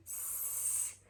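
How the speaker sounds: "s" as a long unvoiced hiss; just the consonant "s", not the letter name; isolated S sound. Shown as a demonstration. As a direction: sound out "s" alone, softly, without voice. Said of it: "você tem o S".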